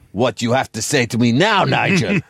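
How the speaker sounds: put-on taunting Nigel in an Italian accent; mock challenge. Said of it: "and I say to him".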